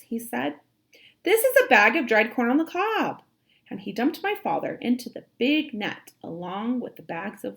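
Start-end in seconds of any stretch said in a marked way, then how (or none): 1.2-3.2 louder, higher know-it-all sailor; confident sailor. A narrator's reading. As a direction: announce it like you're sure.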